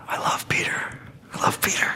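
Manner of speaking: breathy hushed whisper, voice dropped away. Real, not acted. Preacher whispers his love for Peter, a hushed whisper. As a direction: whisper it like a fond secret.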